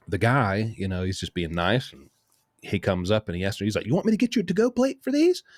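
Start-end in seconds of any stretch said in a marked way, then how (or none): 3.9-5.4 high, fast waiter imitation; eager waiter. Waiter asking about a to-go plate, eager mimicry. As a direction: mimic an over-helpful server, pitched way up. Waiter offers box, retold.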